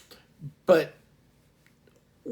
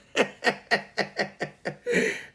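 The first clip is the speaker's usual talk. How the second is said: real breathy burst of laughter; hearty laugh; 10 semitones higher than usual. Real, not acted. Laughing at a viewer's comment.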